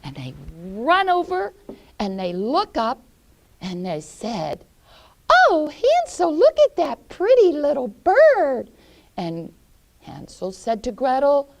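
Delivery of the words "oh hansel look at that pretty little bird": put-on very high, loud thrilled girl voice; delighted girl. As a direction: squeal with delight at the bird.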